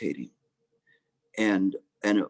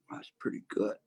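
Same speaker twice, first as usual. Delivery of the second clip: hushed, impressed whisper; little monks whispering to each other, impressed; whispered aside; 9 dB quieter than usual. Acted. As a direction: breathe it to the monk beside you. Monks murmur among themselves.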